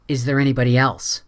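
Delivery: ordinary delivery, nothing marked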